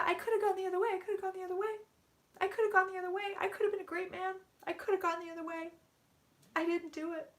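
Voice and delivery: high, strained voice near tears; tearful channeling. Real, not acted. Psychic voices the spirit's regret while crying. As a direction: let the regret break through. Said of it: "I can't believe I'm fucking crying".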